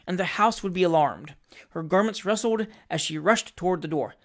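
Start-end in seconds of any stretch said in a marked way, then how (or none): none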